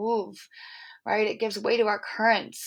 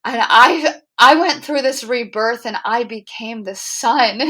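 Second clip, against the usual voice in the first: ecstatic; 3 semitones higher, 11 dB louder than usual. Retold or imitated. Telling friends the climax, loud and ecstatic. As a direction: burst out with the rush of it. Ecstatic peak of retold meditation.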